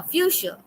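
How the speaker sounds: "fuchsia" said as "FYOO-shuh"; pronunciation demo. Shown as a demonstration. Teacher repeating the played word for the class, pronunciation demo. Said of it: "is pronounced as".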